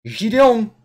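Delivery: put-on loud, high French-accented cry. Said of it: "say it in French accent".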